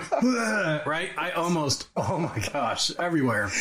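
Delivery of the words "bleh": high-pitched mock gag; imitated retch. Son seeing dad bite the turd, mock retching. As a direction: blurt out a disgusted "bleh". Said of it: "And I went".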